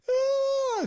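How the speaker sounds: high, loud mock scream of a crying girl; retold scream of a panicking passenger; imitated scream. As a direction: let out a sharp panicked shriek.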